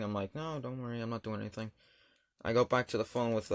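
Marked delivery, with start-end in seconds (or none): 0.3-1.7 dismissive reassurance. Retold or imitated. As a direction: brush mom off quietly. Muted aside reassuring suspicious mom.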